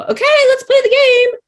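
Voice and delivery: very high, loud, eager put-on voice; excited dog voice. Dog turning to its person to start a game, excited high voice. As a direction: squeal it like a dog keen to play.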